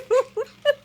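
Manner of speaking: a bright high laugh burst. Real, not acted. Laughing at parrot.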